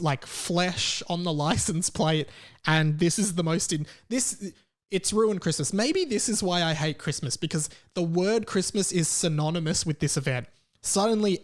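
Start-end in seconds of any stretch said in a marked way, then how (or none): none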